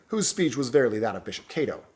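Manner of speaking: ordinary delivery, nothing marked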